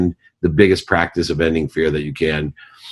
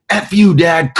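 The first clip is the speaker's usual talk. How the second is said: defiant daughter; 11 semitones higher, 4 dB louder, slower than usual. Retold or imitated. Daughter swears back at father.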